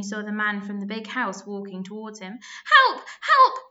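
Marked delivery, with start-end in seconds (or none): 2.6-3.6 much higher, much louder cry for help; a fallen boy screaming for help as the man approaches; desperate yell. A narrator's reading. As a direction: shout for help as if trapped. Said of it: "he yelled".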